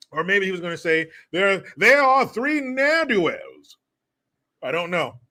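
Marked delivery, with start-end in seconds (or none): 1.3-3.8 high, slow, hammed-up declaration; mock old-fashioned announcer. Imagined commentator avoiding a word, high drawn-out mock declaration. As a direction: stretch and swell the final word.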